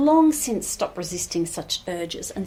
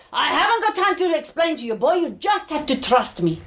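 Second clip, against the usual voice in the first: raised-pitch hurried rebuke, then firm; impatient dismissal; 6 semitones higher than usual. Acted. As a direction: brush off the boy, insist on trust. Healer brushes off the frightened groom.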